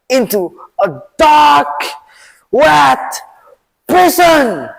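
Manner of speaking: high, loud raging king; angry king shouting to guards about the advisor; furious shout. Acted. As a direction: roar the order to lock him away. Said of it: "and then shout".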